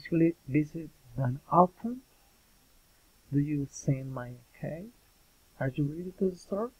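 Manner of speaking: yes-no questions lifting on "often", "cake" and "store"; rising intonation. Shown as a demonstration. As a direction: end "often", "cake" and "store" on a climbing pitch. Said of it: "General question of raising intonation".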